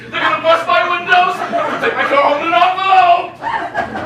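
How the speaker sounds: high, loud protest; shrieked indignation; outraged disbelief. Acted. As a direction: wail in comic outrage. Outrage over the car windows.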